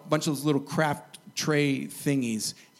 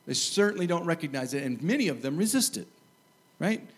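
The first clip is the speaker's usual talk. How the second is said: ordinary talk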